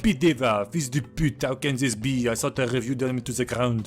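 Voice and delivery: imitating the critic in a hammed-up French accent; mock French accent. Recapper voices the outraged food critic, mock French accent. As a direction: sneer it like a French snob. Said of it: "He's like".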